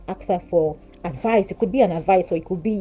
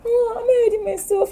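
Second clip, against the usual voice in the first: high squeaky feigned innocence; mock surprise; 4 dB louder than usual. Answering her sister, squeaky mock-innocent surprise. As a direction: play dumb in a tiny high voice. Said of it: "I'm like".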